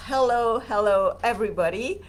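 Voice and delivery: normal talking voice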